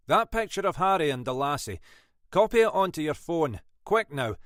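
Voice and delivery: Scottish lilt, quick and decisive; brisk Scottish inspector. Acted. Munro briskly orders West to ring Perth, Scottish accent. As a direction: hurry the sergeant along, Scottish.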